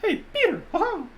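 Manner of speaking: very high cartoon mouse falsetto; high-pitched mouse voice with a little chuckle; Mickey Mouse. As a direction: squeak it like a cheery cartoon mouse. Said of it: "say in the Mickey Mouse voice".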